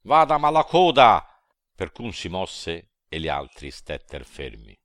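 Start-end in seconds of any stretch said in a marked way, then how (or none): 0.0-1.3 high, loud chorus of devils; all shouting. A narrator's reading. The devil mob shouts for Malacoda, high loud roar. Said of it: "Tutti gridaron".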